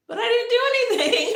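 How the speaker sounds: high, loud laughing protest; incredulous, laughing it out; laughing disbelief. Retold or imitated. Protesting she did nothing, high laughing disbelief.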